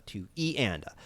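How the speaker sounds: "E" stands out, "two" and "and a" low and soft; accented E. Shown as a demonstration. Teacher showing left-hand accents, stress on E. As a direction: hit "E", let the rest fall away. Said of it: "I'm gonna accent the E".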